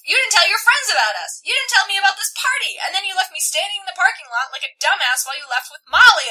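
loud, high acted tirade; indignant, escalating fury; outraged rant. A narrator's reading. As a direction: list grievances, climbing to a shout. Tessa rants at Harry.

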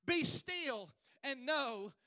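slow, loud, ringing verse; drawn-out pulpit proclamation; emphatic scripture. Acted. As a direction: proclaim it, stretching each phrase. Preacher repeats verse with force.

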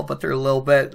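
normal talking voice

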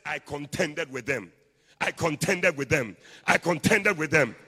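slow, very loud declaration building in force; contending. Acted. Preacher acting the man of God contending with rulers, forceful. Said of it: "This is the man of God contending".

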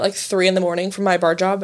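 normal talking voice

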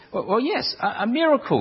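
high-pitched, stammered character answer; ex-leper's eager reply. Retold or imitated. Ex-leper explaining his cure to Brian, high mock earnestness.

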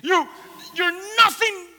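high, loud, acted tirade; furious, anguished accusations; enraged grieving mother. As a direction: lash out in raw grief. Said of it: "Maybe she said things like that".

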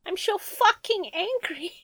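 high, slow whiny fake rage; mock angry villain. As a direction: drag out a hammed-up tantrum. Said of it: "it just comes across as".

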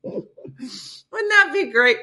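very high, slow sarcastic sing-song. Acted. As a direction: stretch it out mockingly.

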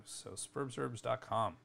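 ordinary talk

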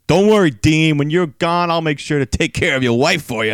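gloating promo into the mic; taunting wrestler. Retold or imitated. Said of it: "grabs the mic from him and says".

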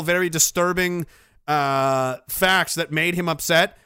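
normal talking voice

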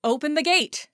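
loud, high shout of open the gate; cry from the wall. Acted. Someone on the wall cries out for the gate, loud. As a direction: call it out so the gate hears. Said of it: "someone cried".

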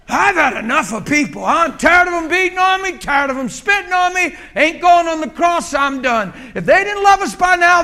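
high, loud, exasperated rant; mock-furious Jesus venting, half laughing; fed-up Jesus. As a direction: shout it like a worn-out savior at the end of his rope. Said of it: "picture Jesus just dropping the cross".

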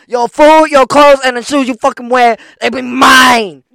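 loud, high villain voice, slowing on the last words; Spike impression. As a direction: boom it out like a greedy cartoon bully. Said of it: "that's Spike".

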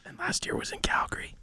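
hushed whispered aside. Real, not acted. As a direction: whisper it like a secret. Joking aside about Calgary.

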